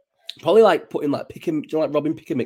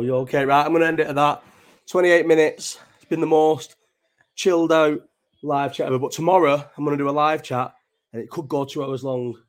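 usual speaking voice

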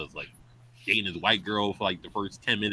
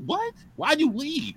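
high, baffled cry of disbelief; a much higher squeak of disbelief at the villain; incredulous outburst; slower than usual. Retold or imitated. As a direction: shout up at the screen in shock. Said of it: "I'm like".

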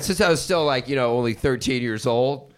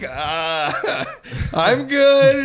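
high, loud nervous laugh through the words; nervous laughing reply; 8 semitones higher, 3 dB louder, much slower than usual. Retold or imitated.

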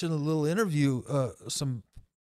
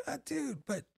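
mock teen protest; 9 semitones higher, 8 dB quieter than usual. Teen self arguing with friends.

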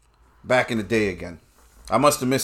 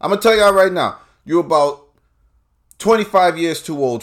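loud warning; 5 semitones higher, 5 dB louder than usual. Real, not acted. Comic warning to audience.

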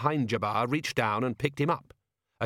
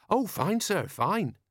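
put-on high-pitched young officer's character voice; Carrot; 9 semitones higher, slower than usual. A narrator's reading. Officer reassuring Vimes about his relationship, high-pitched voice. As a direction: answer the boss in a high, lilting voice.

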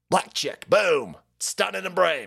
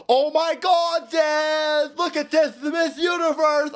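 mock excited squeal; 11 semitones higher, slower than usual. Mocking an excited viewer.

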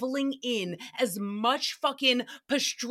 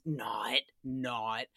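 low quiet retraction; 11 semitones lower, 8 dB quieter than usual. Real, not acted. Retracting sarcasm.

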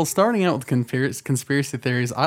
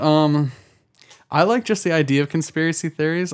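ordinary delivery

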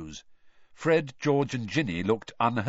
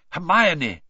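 louder, higher call; urgent; 7 semitones higher, 8 dB louder than usual. A narrator's reading. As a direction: call the name sharply to get moving. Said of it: "more urgently".

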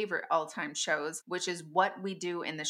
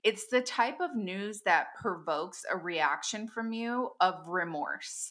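ordinary delivery

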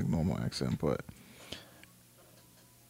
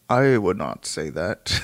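slow, low deliberate answer; Johnny Depp; 5 semitones lower, slower than usual. Retold or imitated. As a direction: imitate a star's slow, measured denial. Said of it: "And he was like".

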